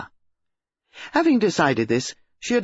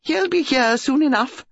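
high, gushing country lady; excited hostess; 11 semitones higher, 3 dB louder than usual. A narrator's reading. Mrs Bantry telling Jane about the body, high gushing. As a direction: rattle on, thrilled and scandalised.

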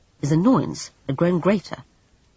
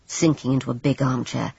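plain reading voice

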